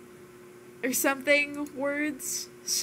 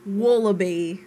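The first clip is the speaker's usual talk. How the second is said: louder, careful Australian WALLABY; attempted Australian accent; 5 dB louder than usual. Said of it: "You can always just say wallabies".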